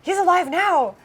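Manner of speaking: high, loud, thrilled question; child bursting with wonder; excited child. Retold or imitated. Child checking with mom, high and loud with joy. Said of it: "She said".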